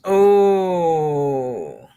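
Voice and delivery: ordinary delivery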